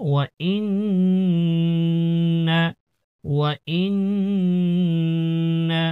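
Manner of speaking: ইন্না with a very long steady nasal n; sustained nasal drone in ইন্না; ghunnah. Shown as a demonstration. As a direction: hum the doubled n of ইন্না through the nose, slowly. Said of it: "গুণনা".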